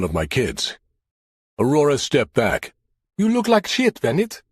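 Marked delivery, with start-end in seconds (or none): 3.2-4.4 put-on high, teasing Jamaican accent. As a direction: tease him in a Jamaican accent. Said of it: "her charming Jamaican accent".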